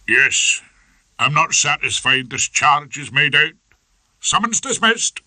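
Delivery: lightly voiced magistrate, brisk ruling. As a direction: magistrate dismissing the case.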